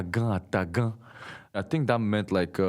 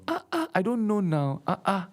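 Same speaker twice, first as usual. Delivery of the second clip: much higher mock pidgin with a stretched now; Nigerian pidgin imitation; 13 semitones higher than usual. Teasing how Nigerian friends talk, high pidgin mimicry. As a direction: exaggerate the pidgin now at the end. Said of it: "they add the now at the end".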